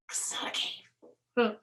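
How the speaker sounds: hushed secret whisper. Pretend. Reader whispering the secret to the child. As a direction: whisper it so the birds won't hear.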